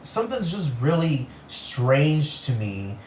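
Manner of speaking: usual speaking voice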